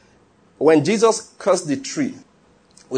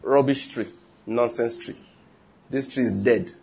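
lower, softer offhand dismissal; understated casual verdict; simple casual words; 5 semitones lower, 5 dB quieter than usual. Retold or imitated. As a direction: say it simply, no force. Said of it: "he said to the tree simply".

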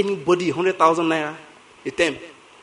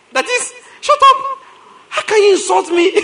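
mock outrage; 14 semitones higher, 5 dB louder than usual. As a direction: ham up the hurt child.

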